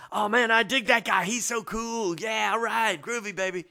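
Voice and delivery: ape Doug's groovy hippie way of talking; laid-back hippie Doug. Retold or imitated. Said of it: "He really did talk like that".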